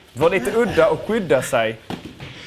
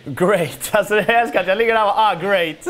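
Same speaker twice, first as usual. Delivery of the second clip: dry mock cheer, higher; sarcastic great; 7 semitones higher, 3 dB louder than usual. Mocking himself on the mat, sarcastic. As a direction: ironic praise of his own flop. Sarcastic about lying there.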